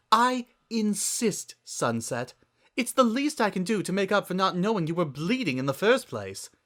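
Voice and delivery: lightly voiced fashionista. Rarity voice.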